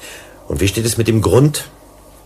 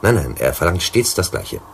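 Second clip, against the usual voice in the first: ordinary talk, nothing marked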